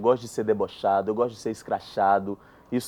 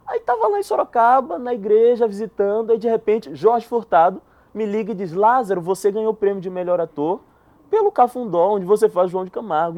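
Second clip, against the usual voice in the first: excited; 8 semitones higher, 6 dB louder than usual. Real, not acted. Animated climax of the story.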